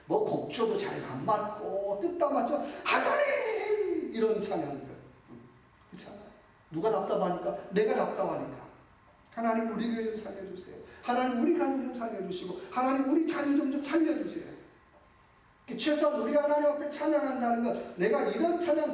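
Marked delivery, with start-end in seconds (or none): 2.8-4.1 much higher, slow drawn-out cry; desperate outcry to God. Shown as a demonstration. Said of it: "절규하는 찬양이에요". 9.3-14.6 anguished pleading prayer; pleading cry. Shown as a demonstration. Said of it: "절규하는 찬양".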